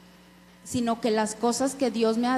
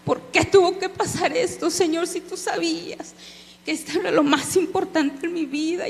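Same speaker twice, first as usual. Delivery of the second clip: higher, pleading cry to God, retold; anguished plea; 5 semitones higher than usual. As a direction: replay the desperate why to the Lord. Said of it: "diciéndole".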